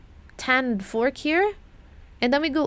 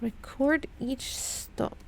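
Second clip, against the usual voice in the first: slow, breathy whisper of the clue; 7 dB quieter, slower than usual. Shown as a demonstration. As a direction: whisper it slowly to yourself. Repeating the clue to herself.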